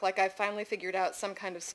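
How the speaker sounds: ordinary delivery, nothing marked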